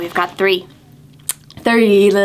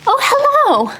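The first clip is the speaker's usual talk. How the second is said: high, startled little hello; surprised; 11 semitones higher than usual. Acted. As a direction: act caught off guard by a visitor. Book character discovers reader.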